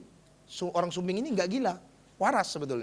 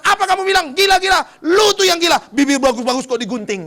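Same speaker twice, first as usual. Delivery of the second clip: loud, high raging shout; furious outburst; 9 semitones higher, 10 dB louder than usual. Acted. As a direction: rage back at the insult. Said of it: "orang gila ini ngamuk juga".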